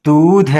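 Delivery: long drawn-out "दूध". Shown as a demonstration. Teacher showing the long ū matra, "दूध" held long. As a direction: hold the ū in "दूध". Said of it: "थोड़ा सा देर तक बोल लें".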